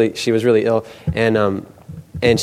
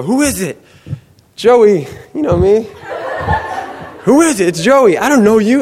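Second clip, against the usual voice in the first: calling through door; 10 semitones higher than usual. Retold or imitated. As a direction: act out shouting through a closed door. Acted shouting through a closed door.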